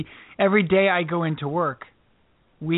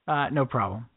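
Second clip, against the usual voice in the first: ordinary talk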